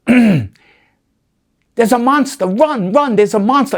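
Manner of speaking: high, loud retold kids' scream; kids screaming. Neighborhood kids warn each other, loud retold scream. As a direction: yell it like scared kids fleeing a monster. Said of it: "all these kids all of a sudden scream".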